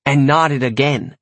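plain reading voice

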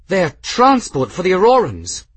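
high, loud cry of realisation; alarmed realisation. A narrator's reading. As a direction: exclaim it as the danger dawns. Sudden breath, alarmed realisation.

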